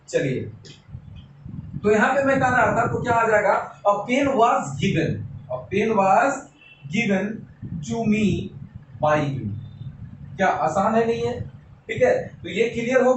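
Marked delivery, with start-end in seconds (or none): 3.8-9.5 slow dictation. Shown as a demonstration. Teacher writing the example.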